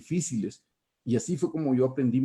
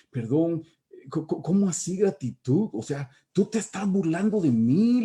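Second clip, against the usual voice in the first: replaying his own outraged reply, much higher; indignant protest; 5 semitones higher than usual. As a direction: sputter back at him as if mocked. Reenacting his protest to counselor.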